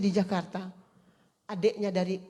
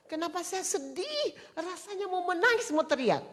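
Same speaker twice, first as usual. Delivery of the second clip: much higher, straining voice on the verge of crying; near tears; 11 semitones higher than usual. Retold or imitated. Preacher at the sickbed, suddenly overcome, near tears. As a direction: let it climb as if about to weep.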